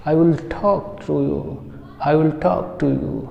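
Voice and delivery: slow model sentence, talk as 'thawk'; aspirated 't' demo. Shown as a demonstration. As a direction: stretch talk with a puffed t and long 'aw'. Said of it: "ठा बोलेंगे". (धीरे बोलें, talk 'ठॉक' जैसा)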